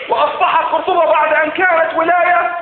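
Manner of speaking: fervent sermon climax, slowing on the last words; rising preaching fervor. Acted. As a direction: build the conquests up to a proud cry. Preacher extols Abd al-Rahman's conquests.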